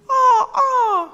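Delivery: high squawking toucan cry; bird squawk. Shown as a demonstration. Reading to children, imitating a toucan squawk. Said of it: "I don't know what sounds a toucan makes".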